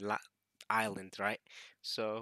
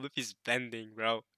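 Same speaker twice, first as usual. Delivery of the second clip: ordinary talk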